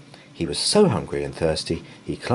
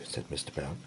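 ordinary delivery

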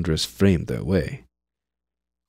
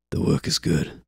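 grunted. Acted. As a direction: grunt it out, few words. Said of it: "Eivor grunted".

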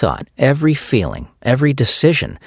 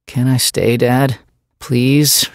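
put-on high, pleading teen girl; desperate pleading; 6 semitones higher, slower than usual. A narrator's reading. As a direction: beg like a desperate teenager. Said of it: "looking desperate".

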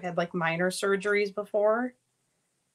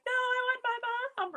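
put-on much higher, whiny little-kid wail; mock crying kid; 3 dB quieter than usual. Imagined scared son at surgery, mock whiny plea. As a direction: whine like a scared child wanting mom. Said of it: "wasn't, like, crying".

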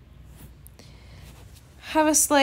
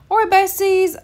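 higher, louder sing-out to viewers; odd perky call; 4 semitones higher, 6 dB louder than usual. Real, not acted. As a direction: chirp it out a little oddly. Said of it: "Why did I say it like that".

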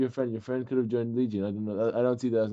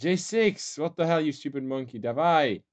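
irritated yelling; 3 semitones higher than usual. Real, not acted. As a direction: chew out a clanmate and hurry them on. Berating clanmate, urging him on.

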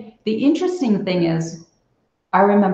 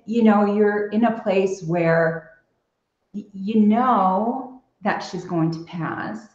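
normal talking voice

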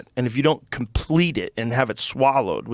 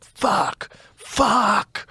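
high-pitched mock scream, held back in volume; retold frustrated yell; 6 semitones higher than usual. Retelling a contestant failing to swallow in time, mock frustrated yell. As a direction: imitate his upset yelling without full volume. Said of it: "He's just fucking screaming and yells".

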